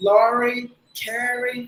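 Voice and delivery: "lorry, carry" with smooth untapped American R. Shown as a demonstration. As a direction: keep the tongue off the palate in "lorry, carry". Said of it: "they will apply the sound of er". ("lorry, carry" அமெரிக்க R ஒலியுடன்)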